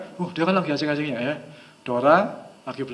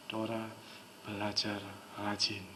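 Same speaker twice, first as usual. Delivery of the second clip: low, very soft, emotionless suggestion; hushed, weighty hypnotic demo; heavy flat intonation; 7 semitones lower, 15 dB quieter, slower than usual. Shown as a demonstration. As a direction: drop each word quietly, pausing between them. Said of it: "intonasinya agak berat".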